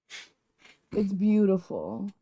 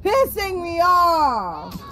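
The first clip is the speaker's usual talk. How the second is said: angry whining; 10 semitones higher, much slower than usual. Real, not acted. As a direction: whine it out, furious and high. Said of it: "Are you mad, dude?".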